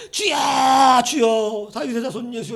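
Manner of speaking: long, high wailing call for help; acted beggar's plea shouted out high; beggar crying out. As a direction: call out desperately, stretching the first word. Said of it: "주님 만나고 싶어서 막 거지된 자가".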